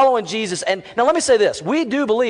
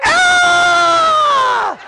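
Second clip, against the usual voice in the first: high, loud mock girls' scream; long, piercing hammed-up scream; horrified scream; 7 dB louder than usual. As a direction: shriek like horrified middle school girls. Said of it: "in horror".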